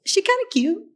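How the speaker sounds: high, coy surprised question; flirting with herself on screen; coy surprise. Retold or imitated. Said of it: "I was like".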